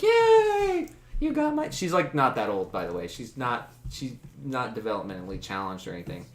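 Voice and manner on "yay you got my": put-on very high girlish cheer; squeaky-high gleeful girl voice; delighted girl. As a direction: cheer like a dancing little girl. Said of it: "starts dancing around and she's like".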